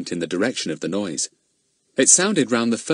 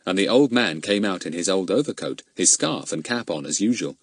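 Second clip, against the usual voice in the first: ordinary delivery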